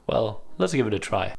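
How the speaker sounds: normal talking voice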